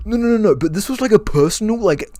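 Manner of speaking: high, panicky whining protest; hammed-up high-pitched meltdown; mock losing it. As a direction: imitate a man unravelling as he complains. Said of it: "he's, like, losing his shit over it".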